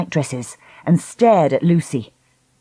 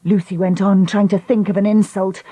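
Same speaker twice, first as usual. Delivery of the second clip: ordinary delivery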